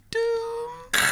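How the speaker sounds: drawn-out deep doom. Acted. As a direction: intone doom slowly. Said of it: "Captain Mannering going".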